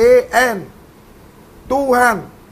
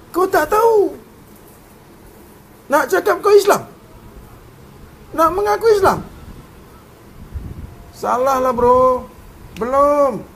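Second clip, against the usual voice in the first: mocking; 4 semitones higher than usual. Real, not acted. Mocking the listener's claim.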